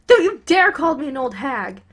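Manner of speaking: loud scolding yell; a mother shouting her son down; angry mother. Acted. Mother yelling back at Bakugo over the insult, furious.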